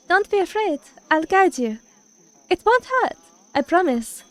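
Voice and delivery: lightly voiced comforting priestess; reassuring priestess. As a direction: soothe a frightened girl.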